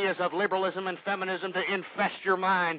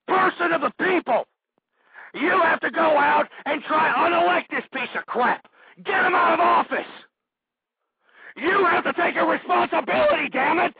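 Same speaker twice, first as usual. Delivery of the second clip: higher and louder, shouting down the politicians; furious shout; 6 semitones higher, 4 dB louder than usual. Real, not acted. Talk host ranting at his audience, furious shout. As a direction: yell it like you've had enough.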